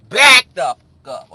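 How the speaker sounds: loud, forceful back-off; shouted rebuff. Acted. Showing how she told him to back off, shouted. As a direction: holler it at a pushy man.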